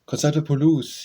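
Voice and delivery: firm official advice, lightly acted; authoritative. A narrator's reading. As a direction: pronounce it with some authority.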